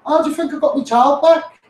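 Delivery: normal talking voice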